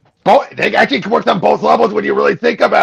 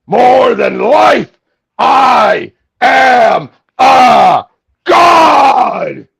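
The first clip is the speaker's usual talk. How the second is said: booming megalomaniac villain rant; Skeletor voice; 3 semitones lower than usual. Acted. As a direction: rant like a power-mad villain. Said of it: "do your Skeletor voice".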